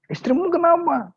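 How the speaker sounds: very high, surprised question; startled concern. Acted. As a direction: ask in sudden alarm. Rich friend asks about the wife.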